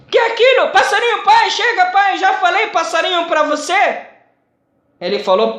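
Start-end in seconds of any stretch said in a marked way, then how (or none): 0.1-0.7 much higher, louder shout; shouted question. Retold or imitated. Old father shouts question at his son again. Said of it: "Começou de aumentar o voz". 0.7-4.1 irritated raised voice. Retold or imitated. Son loses patience with his old father, annoyed. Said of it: "Começou de aumentar o voz".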